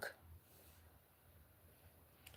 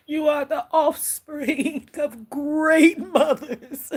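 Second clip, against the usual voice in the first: slow words broken by laughter; laughing; 4 semitones higher, much slower than usual. Real, not acted. Medium relaying the ancestors' blessing, laughing slowly. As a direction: laugh your way through the blessing. Laughing through an emotional message.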